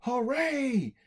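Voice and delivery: high cheers from a happy crowd; crowd cheering. Acted. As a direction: shout hooray like a crowd. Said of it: "And everyone went".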